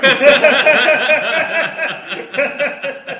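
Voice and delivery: high loud laugh; big burst of laughter. Real, not acted. Laughing at his own chop saw joke. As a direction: crack up loudly.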